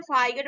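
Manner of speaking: फाइगट in two syllables, fai-gat; wrong sounded G. Shown as a demonstration. Teacher quoting what many people say, फाइगट with G. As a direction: put a hard G inside फाइगट. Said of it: "बहुत लोग क्या बोलते हैं".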